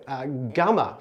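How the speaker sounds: ordinary talk, nothing marked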